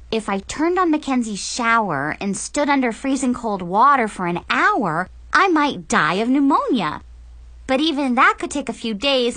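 ordinary delivery, nothing marked

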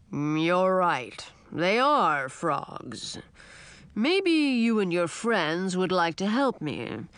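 put-on slow, rumbling old dragon; a grand old dragon, taking his time; old dragon king.

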